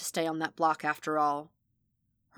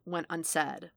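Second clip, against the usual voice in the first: ordinary delivery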